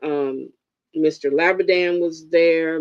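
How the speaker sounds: ordinary delivery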